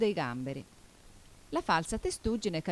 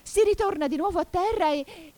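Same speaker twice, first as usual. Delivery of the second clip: high, raised voice; excited dance call; 12 semitones higher, 6 dB louder than usual. A narrator's reading. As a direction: call out the final step with gusto. Last shouted dance call before calming.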